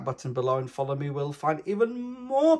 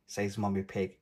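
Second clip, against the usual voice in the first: plain reading voice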